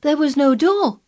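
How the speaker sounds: high, loud objection; crying out. A narrator's reading. Colin doubting anyone got in, crying out. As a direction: protest it at high pitch. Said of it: "cried Colin".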